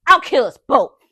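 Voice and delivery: re-enacted furious shout, very loud; shouted threat. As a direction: shout it as a raging driver's threat. Retold threat from raging girlfriend.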